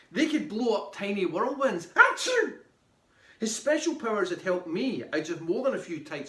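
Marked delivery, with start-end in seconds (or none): 1.9-2.6 high, loud stage sneeze; explosive sneeze. A narrator's reading. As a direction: let out a huge spectral achoo. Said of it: "As for his spectral sneezes".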